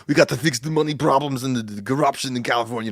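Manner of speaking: lightly imitated Austrian accent; a hint of Austrian governor voice; Arnold's Austrian accent. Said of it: "he was governor of California".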